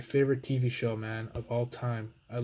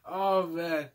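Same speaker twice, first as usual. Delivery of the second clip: normal talking voice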